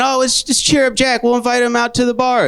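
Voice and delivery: a hint of chirpy friend voice; mocking friends. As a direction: play the peppy pal planning a night out. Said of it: "They're saying".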